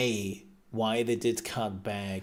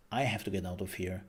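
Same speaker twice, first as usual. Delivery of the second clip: ordinary talk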